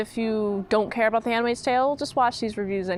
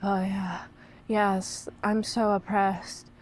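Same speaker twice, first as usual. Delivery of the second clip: mock breathy whisper, slow; imitating the narrator's whispery voice; 4 semitones lower, 6 dB quieter, slower than usual. Mocking the show's narrator, soft slow whisper. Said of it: "such a whispery way".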